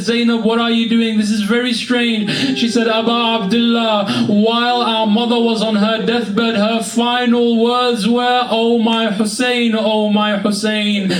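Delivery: high, rising mournful preaching voice; impassioned preaching. Acted. Preacher voicing Zainab to mourners, high impassioned lament. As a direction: build the grief, pitch climbing. Climax of mourning sermon narration.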